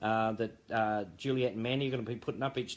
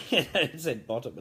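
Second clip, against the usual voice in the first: amused, slow, higher-pitched and breaking into laughter; laughing; 5 semitones higher, 7 dB louder, slower than usual. Real, not acted. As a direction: giggle through the words. Giggling at the misprint he read.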